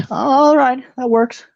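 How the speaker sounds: high-pitched, accepting official; ticketmaster. Acted. Ticketmaster approving the written answer, high character voice. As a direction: pitch it well up as you approve. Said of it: "He's like".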